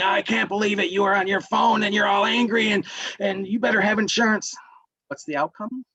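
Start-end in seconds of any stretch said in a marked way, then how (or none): 0.0-4.6 higher, heated quoted rant; mock raging driver. Driver raging at the other driver after a crash, heated and high. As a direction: act out a road-rage driver tearing into the other. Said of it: "just raging towards you and saying".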